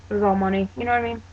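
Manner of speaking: normal talking voice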